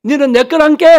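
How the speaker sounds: high, loud attempt at Jeolla dialect; 서툰 전라도 사투리. Shown as a demonstration. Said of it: "전라도 버전 제가 못하지만은". (높고 크게 흉내 낸 전라도 말투)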